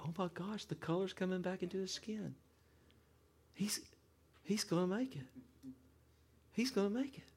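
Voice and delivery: soft, high-pitched dawning relief; hushed amazement. Retold or imitated. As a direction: marvel softly, pitch lifting with hope. Seeing father revive.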